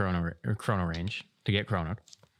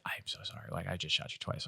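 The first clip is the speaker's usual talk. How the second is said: put-on soft, near-whispered sorry from an embarrassed kid; sheepish apology; 8 dB quieter than usual. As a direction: mumble it out, ashamed. Kid apologizing after second shot.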